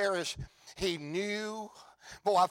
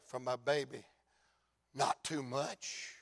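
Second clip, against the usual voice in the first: much softer and lower, choked with tears; tearful father; 8 semitones lower, 10 dB quieter than usual. Acted. Grieving father tells the crowd about his baby, tearful and hushed. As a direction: say it through tears, barely audible. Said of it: "And I can hear him say".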